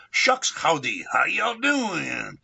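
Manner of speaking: drawled cowboy howdy greeting, stretched out slow; Texan-Chicano greeting. Shown as a demonstration. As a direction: switch from Chicano to Texan mid-sentence. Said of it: "we created the greeting".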